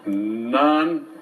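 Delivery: ordinary talk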